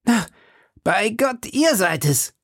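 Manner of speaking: high, delighted exclamation; joyful high-voiced cry; jubilant Porthos. A narrator's reading. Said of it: "Porthos erhob ein lautes Gejubel".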